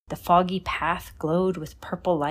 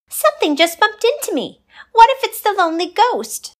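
put-on high loud frightened girl voice; panicky ballet girl; 9 dB louder than usual. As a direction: squeal it in panic.

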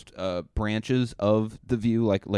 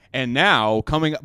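raised, punchy news anchor voice; loud broadcast lead-in, pitched up; 4 semitones higher, 8 dB louder than usual. Shown as a demonstration. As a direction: announce it like a TV newsreader. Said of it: "there is still news voice".

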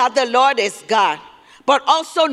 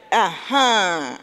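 ordinary talk

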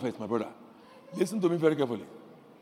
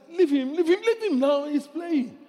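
indulgent grandpa; 7 semitones higher, 5 dB louder than usual. Retold or imitated. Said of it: "your dad says".

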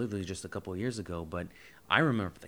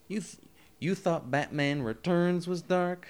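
sassy; 8 semitones higher, 8 dB louder, slower than usual. Acted. Sassy rhetorical jab.

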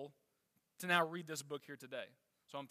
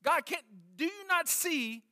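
retold anguished plea, pitched well higher; desperate cry to God; 6 semitones higher, 3 dB louder than usual. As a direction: act out David's desperate cry, begging God. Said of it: "he cries out".

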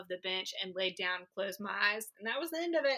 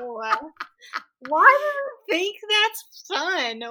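much higher, louder incredulous outburst; loud disbelief; 13 semitones higher, 12 dB louder, slower than usual. Real, not acted. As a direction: exclaim in real disbelief. Outraged disbelief at haunted houses.

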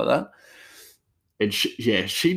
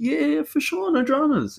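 very high, sing-out easygoing reply; breezy reassurance. Retold or imitated. Cook agreeing to a chat with a coworker, high breezy reply. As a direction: imitate an easy yes, pitched way up.

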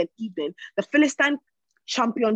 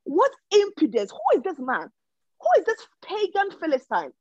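indignant outrage; 7 semitones higher than usual. Retold or imitated. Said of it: "this guy's like".

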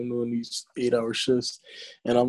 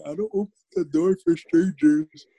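childish mock; 6 semitones higher than usual. Mocking childish excuse.

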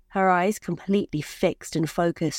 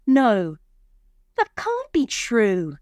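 higher-pitched, drawn-out disbelief; protesting; 5 semitones higher, 4 dB louder, slower than usual. A narrator's reading. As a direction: protest in a high, stretched voice. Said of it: "she protested".

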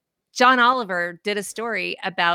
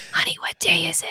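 whispered quote; 2 semitones lower than usual. Retold or imitated. Host re-enacts waking Charlie up on Fridays, whispering. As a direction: whisper it gently, no voice.

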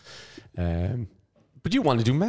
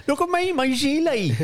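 much higher, laughing put-on farmer voice; mock farmer voice. Host teases his friend's gilet, high mock farmer voice. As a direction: squeal it out as a silly farmer showing off his gilet.

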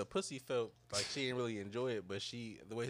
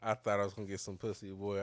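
ordinary talk, nothing marked

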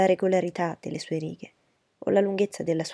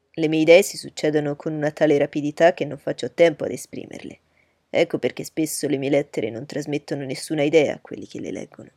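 louder, lower, teasing his friend; hearty young gentleman; 3 semitones lower, 7 dB louder than usual. Acted. Bingley teasing Darcy before his sister, loud and hearty. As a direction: tease Darcy openly and loudly.

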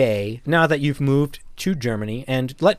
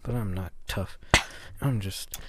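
quieter and quieter, self-mocking; fading to a whisper; 3 semitones lower, 8 dB quieter than usual. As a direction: trail off into a whisper.

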